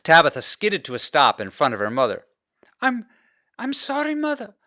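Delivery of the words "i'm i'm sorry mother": gasping. Acted. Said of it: "she gasped".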